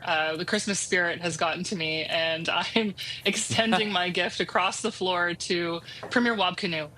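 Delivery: ordinary talk, nothing marked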